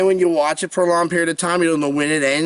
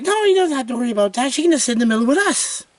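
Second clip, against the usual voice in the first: imitating mom; 8 semitones higher, faster than usual. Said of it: "And the mom's like".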